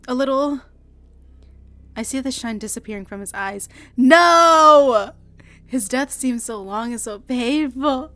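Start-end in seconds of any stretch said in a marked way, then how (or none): 4.0-5.1 loud sharp cry; loud desperate no; anguished no. Acted. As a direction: cry out in grief. Watching the shine leave his eyes.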